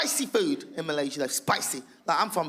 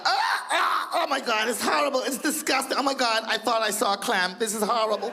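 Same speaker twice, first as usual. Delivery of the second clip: disgusted cruise guests; 5 semitones higher than usual. Retold or imitated. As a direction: recoil in hammy horror.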